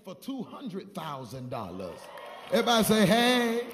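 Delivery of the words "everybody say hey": loud rallying call; preacher's loud call-and-response. Real, not acted. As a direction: shout it to get everyone answering. Preacher rallies congregation to respond.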